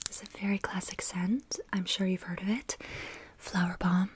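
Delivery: usual speaking voice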